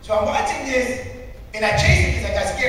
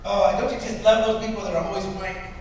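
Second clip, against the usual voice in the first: ordinary talk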